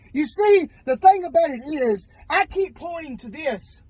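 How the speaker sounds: ordinary talk, nothing marked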